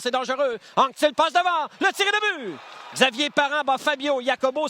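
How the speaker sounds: goal call. Real, not acted. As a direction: belt out the goal. Goal call, second announcer.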